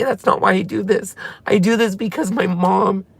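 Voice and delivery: normal talking voice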